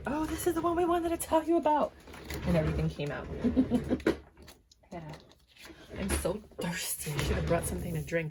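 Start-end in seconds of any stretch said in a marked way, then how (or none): 0.1-1.9 much higher put-on voice; voicing the cards. Reader speaks for the cards about Taurus, high mock voice. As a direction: pipe up high as the cards announcing themselves. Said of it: "So they were like".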